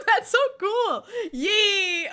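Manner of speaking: high, loud squeal through laughter; gleeful cheer. Real, not acted. Streamer celebrates a viewer's clever find, gleeful cheer. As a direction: cheer excitedly while laughing.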